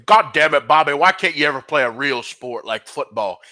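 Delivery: exasperated cartoon dad; King of the Hill dad impression; Hank. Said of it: "well, that's Hank".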